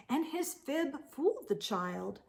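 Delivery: ordinary delivery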